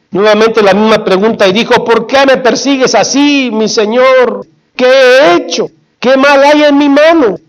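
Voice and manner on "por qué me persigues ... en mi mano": high-pitched, insistent questioning; retold pleading protest. David asks Saúl why he pursues him, pleading protest.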